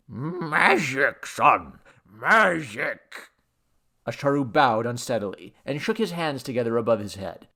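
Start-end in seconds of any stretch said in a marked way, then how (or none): 0.1-3.3 slow tipsy slur; drunken god. A narrator's reading. Drunk god explaining his trick, slurred. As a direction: stretch the words like a lofty drunk.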